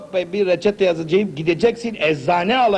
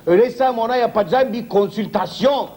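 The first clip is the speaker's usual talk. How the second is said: ordinary talk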